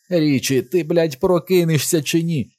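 high, desperate demand; trembling frantic yell. A narrator's reading. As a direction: plead angrily for him to wake. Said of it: "Його голос затремтів".

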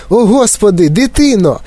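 high-pitched alarmed cry; shrieking. A narrator's reading. Mother suddenly notices her daughter's face, shrieking. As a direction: shriek in motherly horror, without full volume. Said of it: "заверещала раптом пані Борегард".

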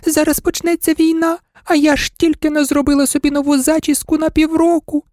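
very high doll voice, lamenting; wailing doll. A narrator's reading. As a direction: wail like a vain, frightened doll. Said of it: "лялька Роза залементувала". (тоненький лемент ляльки)

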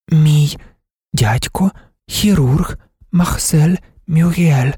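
slow, high, word ... by ... word; unmodulated, choppy speech. A narrator's reading. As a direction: deaf woman naming someone in separated words. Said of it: "Вона вимовляла немодульовано й уривчасто".

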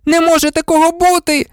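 put-on very high girl's cry; high, shouted protest; shrieked disbelief. A narrator's reading. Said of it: "крикнула Герміона".

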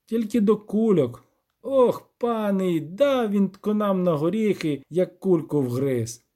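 high, drawn-out complaint; groaning lament. A narrator's reading. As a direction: moan on in mild dismay. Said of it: "простогнав Кропивник".

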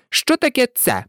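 high, testy mouse question; rather irritated. A narrator's reading. Mouse challenging the duck, rather irritated. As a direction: put it testily in a high voice. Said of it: "досить роздратовано відповіла миша".

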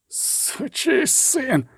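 high, strained, drawn-out curse; squeezed-out groan. A narrator's reading. Dying killer curses the one who tricked him, agonised squeezed-out voice. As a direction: force it out through the pain. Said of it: "вичавив він".

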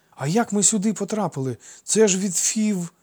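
put-on high, loud boyish question; astonished childish voice; bewildered child. A narrator's reading. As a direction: ask in puzzled alarm. Boys realise how far they are.

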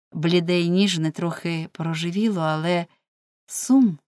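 usual speaking voice

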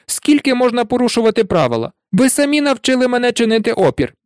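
high, quick, defiant tirade; worked-up boy standing his ground; indignant protest. A narrator's reading. Neville keeps protesting after his cry.

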